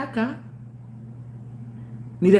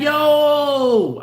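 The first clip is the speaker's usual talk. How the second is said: loud yo; 7 semitones higher, 4 dB louder than usual. Retold or imitated. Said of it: "I'm like".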